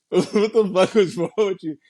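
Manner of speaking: high mock-baffled Jamie; Jamie's retort. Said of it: "he was like".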